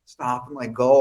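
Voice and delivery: quiet, timid plea to stop; soft pleading girl. Retold or imitated. Girl begs her friend to stop fighting, soft plea. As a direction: hush it like a nervous girl begging. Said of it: "she's like".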